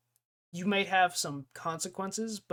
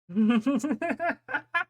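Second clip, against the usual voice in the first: high, loud burst of laughter; real laugh; 10 semitones higher, 8 dB louder than usual. Real, not acted. Streamer reacting to chat, high loud laugh.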